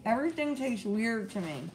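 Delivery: talking with food in mouth; muffled mid-chew speech. Real, not acted. Seen 0: Bites at -4 s, lips chewing until about 2.5 s.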